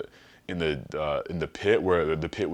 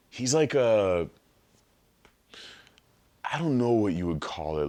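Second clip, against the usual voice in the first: ordinary talk, nothing marked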